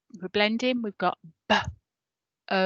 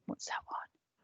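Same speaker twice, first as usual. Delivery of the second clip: slow secretive whispered hint; 15 dB quieter, slower than usual. Real, not acted. Teacher whispering a hint to the class. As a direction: lean in and whisper the clue.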